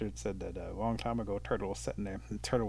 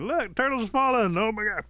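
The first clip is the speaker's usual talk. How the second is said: high, loud cries of alarm; alarmed shouting; 12 semitones higher, 7 dB louder than usual. Acted. Animals watch the turtle fall, alarmed high shouts. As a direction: yell it out in high-pitched horror.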